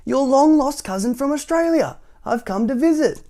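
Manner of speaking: high, loud, exuberant character voice; clumsy cousin's announcement. A narrator's reading. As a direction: proclaim your surprise visit. Cousin announces his visit.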